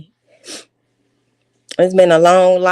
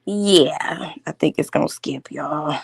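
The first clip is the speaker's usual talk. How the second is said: hushed unvoiced aside; whisper; 6 semitones lower, 4 dB quieter, slower than usual. Real, not acted. Whispering to viewers that the stream may skip.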